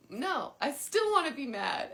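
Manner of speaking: put-on loud, high childish protest; mock stubborn refusal. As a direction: dig your heels in playfully.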